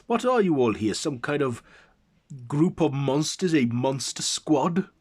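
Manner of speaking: loud, high, disbelieving question; incredulous challenger. A narrator's reading. Challenger sizing up the group of monsters, loud disbelief.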